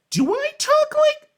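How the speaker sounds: very high, loud, drawn-out question; mock self-doubt. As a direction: squeal it as an exaggerated fake-voice realization. Imagines listener doubting own fake voice.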